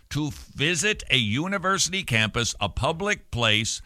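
usual speaking voice

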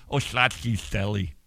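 silly slushy s sounds in "Schlotsky's"; lateral lisp. Retold or imitated. Host recalling family minivan silliness, "Schlotsky's" in a lateral lisp. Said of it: "where we would talk like this".

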